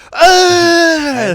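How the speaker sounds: loud, high drawn-out shriek; hammed-up horrified wail; mock scream. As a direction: shriek in exaggerated horror. Mimicking shoppers' horror.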